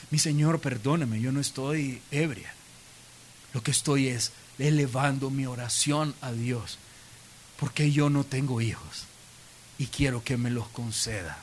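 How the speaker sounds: low, soft, meek explanation; humble pleading. Retold or imitated. Ana explaining her prayer to Eli, humble and hushed. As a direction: apologise gently and respectfully.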